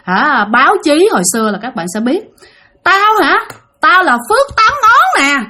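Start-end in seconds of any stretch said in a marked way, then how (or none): 2.8-5.4 high, boastful gangster spirit; Phước Tám Ngón. Acted. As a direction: announce yourself slowly, high and proud. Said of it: "cái chị này nói làm sao các bạn biết không". (giọng vong cao, xưng danh ngạo nghễ)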